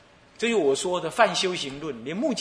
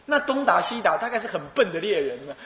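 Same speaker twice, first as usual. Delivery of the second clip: ordinary talk, nothing marked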